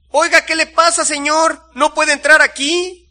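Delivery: high, loud protest from a juice vendor; indignant exclamation. A narrator's reading. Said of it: "exclamó el encargado del puesto de jugos". (exclamación indignada, aguda y a gritos)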